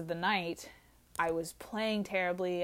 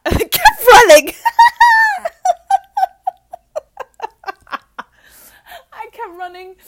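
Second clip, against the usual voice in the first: loud, much higher laugh through the words; laughing hard; 21 dB louder than usual. Real, not acted. Retelling the flight to viewers, shrieking with laughter. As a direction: crack up while saying it.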